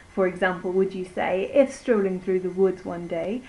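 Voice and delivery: ordinary delivery, nothing marked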